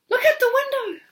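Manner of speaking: put-on very high, loud girl; excited girl. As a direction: point it out eagerly. Rose voiced.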